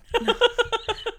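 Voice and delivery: high-pitched amused cackle; high burst of laughter. Real, not acted. Reacting to friend's period excuse, laughing. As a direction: crack up at a friend's joke. Cracks up at friend's suggested line.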